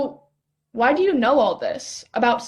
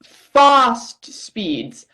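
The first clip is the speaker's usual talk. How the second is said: put-on British accent; 5 dB louder than usual. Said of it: "better with the accent".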